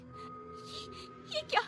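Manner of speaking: gasping, frightened stammer; horrified stammer. Acted. Priya seeing worms in her vomit, horrified. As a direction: falter in horror.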